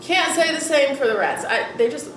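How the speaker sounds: ordinary talk, nothing marked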